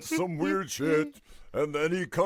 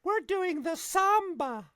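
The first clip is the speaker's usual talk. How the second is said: high, slow put-on lady voice; lady in the car; slower than usual. Lady caught in the car answers the officer, high put-on voice. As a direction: squeak it out like the caught woman. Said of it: "this lady goes".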